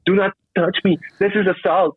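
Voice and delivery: high, indignant mock voice; mocking offended woman. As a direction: mimic her high outrage. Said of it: "she's like".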